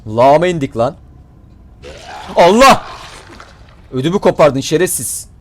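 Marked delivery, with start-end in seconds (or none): 2.3-2.8 startled cry. Real, not acted. Jump scare in sewer.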